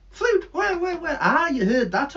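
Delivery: high, eager bird squeak; greedy bird puppet hearing fruit; excited puppet bird. Acted.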